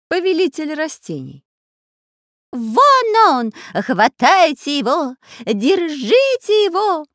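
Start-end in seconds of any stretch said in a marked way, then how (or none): 2.5-7.0 high, loud, urgent cries; shouting. A narrator's reading. Spy spots the villain in the sky, shouting. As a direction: yell the orders, stretching the words. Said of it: "закричал шпион Дырка".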